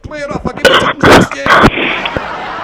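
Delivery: slow, loud, high call up the cliff; shouted up. Retold or imitated. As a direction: bellow it up to the man on the ledge. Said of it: "a fella got out and shouted up".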